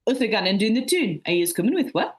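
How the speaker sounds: broad Mackem accent, a bit calmer; Sunderland dialect. Shown as a demonstration. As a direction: repeat it in a thick Sunderland accent. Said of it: "I'll repeat it".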